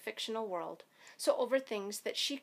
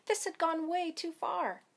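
high, exasperated declaration; fed up; 8 semitones higher than usual. A narrator's reading. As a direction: voice her thought as the last straw. Fed-up thought of the girl.

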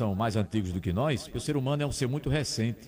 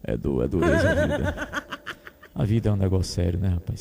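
put-on much lower, slow croaky old man complaining; grumpy old man; 5 semitones lower than usual. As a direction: groan through it like a tired grumpy old man. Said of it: "voz de velho". (voz de velho rabugento)

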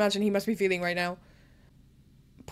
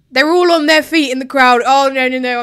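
overwhelmed; 2 semitones higher, 7 dB louder than usual. Real, not acted. Emotional moment as Ferrari leads.

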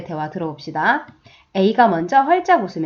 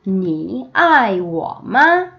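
very slow, each syllable drawn out; slow choral reading; slow read-along; much slower than usual. Shown as a demonstration. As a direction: read slowly with the class. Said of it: "한 번 더".

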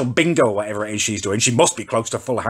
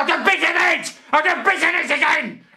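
pained shout; 14 semitones higher than usual. Real, not acted. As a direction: yell it as you bite your tongue. Bites his sore tongue.

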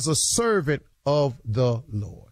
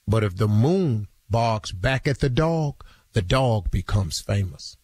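quoting a mentor; 7 semitones lower than usual. Retold or imitated. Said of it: "He said".

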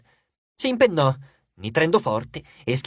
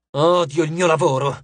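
growling. A narrator's reading. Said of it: "ringhiò Reina".

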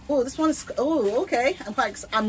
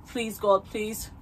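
ordinary talk, nothing marked

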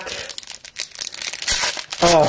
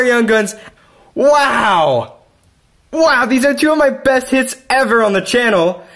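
excited yelling; 11 semitones higher than usual. Real, not acted. Collector yelling at his cats' expense over a rookie card, real excited yelling. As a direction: scream it like the pull of your life. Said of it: "I've been yelling for the past two minutes".

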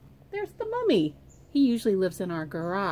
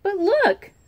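high, loud call; yelled; 12 semitones higher, 7 dB louder than usual. Acted. Child yells at the sisters, loud and high. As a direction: yell to get their attention. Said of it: "I yelled".

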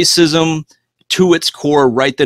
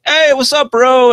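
excited greeting; 13 semitones higher, 5 dB louder, slower than usual. Retold or imitated. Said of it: "I'm like".

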